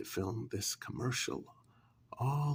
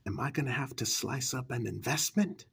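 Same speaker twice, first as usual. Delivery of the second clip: hurried exit; faster than usual. Acted. Rushing off to deal with it.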